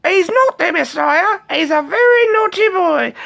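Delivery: shrill Pythonesque mum scolding; Brian's mother voice. Retold or imitated. Quoting the Life of Brian mother to chat, falsetto. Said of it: "he did the voice spot on perfect".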